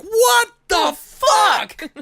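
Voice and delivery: very high, loud, slow stretched shriek; a stunned scream of disbelief; shocked shriek. Acted. The wife seeing the dog explode, shrieking in shock.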